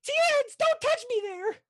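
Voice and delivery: very high, loud squawk; hammed-up high British woman protesting. Player voicing an offended woman, high British squawk. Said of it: "similar to your [name] accent".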